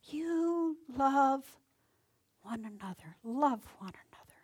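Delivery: Jesus's farewell, ending in a whisper; tender Jesus. Acted. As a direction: speak it as a last plea, softening to a whisper. Jesus's words before crucifixion.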